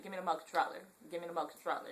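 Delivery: "remote controller" with no "re", just "mote"; re- dropped. Shown as a demonstration. Vlogger showing how home says it, re- dropped. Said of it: "We don't even say re nothing".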